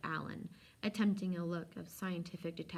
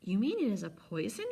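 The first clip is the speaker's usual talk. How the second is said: alarmed question, a little louder; horrified cry; 4 semitones higher, 5 dB louder than usual. A narrator's reading. Alan to the old man on hearing of poison, horrified. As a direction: recoil in horror as it dawns on you. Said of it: "cried Alan, very much horrified".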